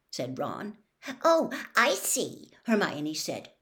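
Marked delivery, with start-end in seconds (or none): 1.2-2.5 slow, higher, huffy offense; cold, pointed disapproval; bristling. A narrator's reading. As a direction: bristle at what he just said.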